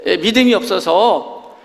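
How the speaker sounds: a lightly hammed-up Moses complaining; Moses pleading. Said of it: "모세가 또 하나님 앞에 가고".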